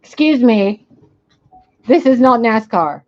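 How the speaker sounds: loud, indignant call-out; loud scold. Real, not acted. Owner loudly scolding her racing dog.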